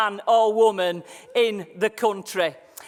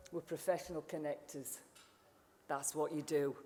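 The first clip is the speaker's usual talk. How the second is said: much softer, lower, drawing the room in; intimate low point; 7 semitones lower, 15 dB quieter than usual. Acted. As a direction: hush it like a secret you trust them with. Drops voice to make key point.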